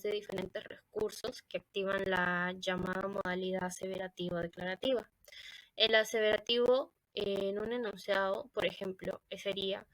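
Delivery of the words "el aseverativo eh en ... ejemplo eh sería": ordinary talk, nothing marked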